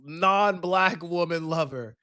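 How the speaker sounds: slow, grand royal announcement; royal title proclamation. Acted. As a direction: proclaim it like a herald. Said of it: "that just sounded like a royal title".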